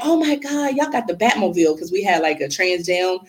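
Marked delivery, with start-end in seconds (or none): 0.0-1.8 mock excited kid. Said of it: "That's like".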